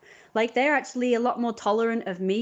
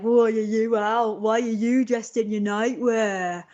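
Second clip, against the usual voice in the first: mocking teen question; 3 semitones higher, 5 dB louder, slower than usual. Teen voiced questioning her.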